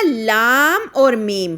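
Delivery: لام and میم slowly stretched with long vowels; drawn-out recitation. Shown as a demonstration. Teacher shows the students the long madd, slow. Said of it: "یہاں پر یاد رکھنا".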